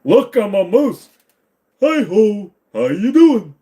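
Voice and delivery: high, loud, slow silly moose call; goofy moose voice. Retold or imitated. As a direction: yell from the treestand like a cartoon moose. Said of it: "I'd just be going".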